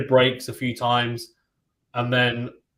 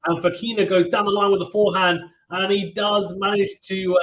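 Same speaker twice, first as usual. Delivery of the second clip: higher, louder live tennis call; excited play-by-play; 6 semitones higher, 3 dB louder than usual. Real, not acted. Commentator calls the winning point of the set, excited.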